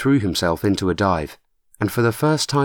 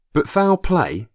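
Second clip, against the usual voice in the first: higher, doubting, stress on foul; incredulous; 9 semitones higher, slower than usual. A narrator's reading. Nick doubting Matt's murder theory, incredulous and higher. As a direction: scoff at the idea.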